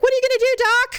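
high-pitched mock worried kids; imagined worried onlookers. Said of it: "had a look on their face like".

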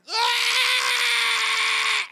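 high, loud crying shriek; long, high, crying scream; panicked child's scream. Retold or imitated. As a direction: scream like a terrified little kid.

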